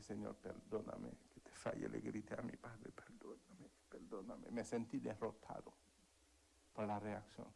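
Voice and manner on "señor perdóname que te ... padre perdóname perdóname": humble prayer. Retold or imitated. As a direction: pray it under your breath. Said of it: "me quedé en mi habitación y dije".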